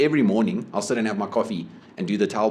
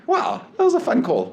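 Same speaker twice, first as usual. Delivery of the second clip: very high, giddy wow; amused delight; 3 dB louder than usual. Retold or imitated. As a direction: squeal it with amused glee. Recalls his delight at the call.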